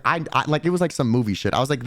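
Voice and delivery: ordinary talk, nothing marked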